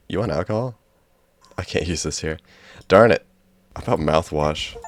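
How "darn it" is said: loud, high snap of annoyance; frustrated outburst. Real, not acted. Player annoyed the item won't work, loud frustrated snap. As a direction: blurt it at the stuck game.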